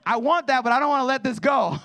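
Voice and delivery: high, loud and pleading, torn between two wants; a father playing his baby's inner struggle; voicing toddler's thoughts. Father imitates torn baby daughter, high and loud.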